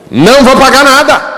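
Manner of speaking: high, loud defiant refusal; defiant shout. Acted. Pastor voicing his stubborn unconscious, a loud defiant shout. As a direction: stamp your foot and refuse.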